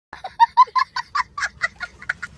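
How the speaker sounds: high laugh. Real, not acted. Foreigner laughing at himself.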